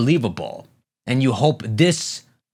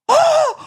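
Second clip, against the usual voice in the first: high loud shocked gasp; sharp gasp; 5 dB louder than usual. Real, not acted. Shocked by Conqueror's Haki.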